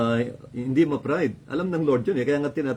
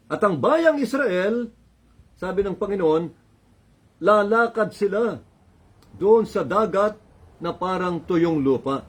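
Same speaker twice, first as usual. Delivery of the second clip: preaching; 5 semitones higher than usual. Acted. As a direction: preach it with rising fervor. Preaching at full force.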